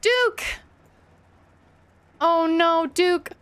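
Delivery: high, drawn-out cry of dismay; dismayed calling out. Real, not acted. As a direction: call to a friend in trouble. Calls out to endangered game character.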